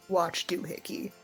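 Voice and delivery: ordinary talk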